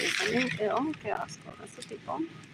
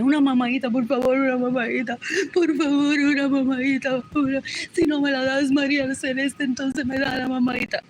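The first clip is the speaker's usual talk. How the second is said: louder hammed-up begging; mocking loud plea; 5 dB louder than usual. Mocking relatives' demand, loud and hammed-up. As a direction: beg loudly in mockery.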